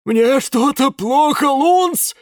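high, loud panicked plea. A narrator's reading. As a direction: cry out in sudden fear. Character suddenly ill, panicking.

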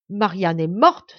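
loud, slow exclamation of shock; shocked cry. A narrator's reading. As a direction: cry out the dreadful news in disbelief. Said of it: "s'écria-t-il". (cri de stupeur, fort et lent)

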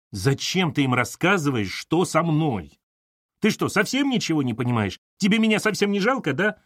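high-pitched, agitated reproach; upset accusation. Acted. Daughter reproaches mother for telling callers, upset. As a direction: accuse her with pitch climbing.

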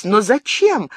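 why on earth would he run; surprised question. Acted. Diana asks Gabriel why he must flee, surprised. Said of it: "спросила она удивлённо".

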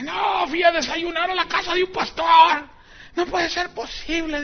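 high, strained, scandalized pulpit cry; hammed-up indignant exclamation; mock outrage. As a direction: imitate a pastor aghast at what he found. Retelling a pastor's outraged denunciation.

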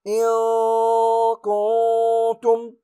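إن كنتم with nun hidden in a long high nasal hum, very slow. Shown as a demonstration. Teacher reciting the hidden nun, slow and high. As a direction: recite إن كنتم with drawn-out ikhfa. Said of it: "نقول".

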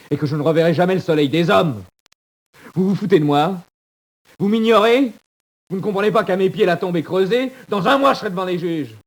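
voix très haute et forte, désespérée; cri désespéré. Acted. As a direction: hurle ta peur de la mort. Désespoir éclate devant les codétenus. (détenu hurlant sa condamnation)

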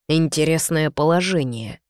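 plain reading voice

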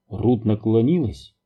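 ordinary delivery, nothing marked

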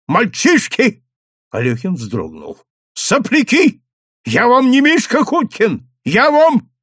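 резко выше и громче, гневно; накричать на юнцов; гневный окрик. A narrator's reading. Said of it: "резко стукнул в стол". (управляющий орёт на сыщиков)

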